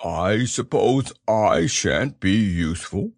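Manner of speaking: a bit forlorn, drawn out; understated sad bear; sad Pooh. A narrator's reading. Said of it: "said Pooh sadly".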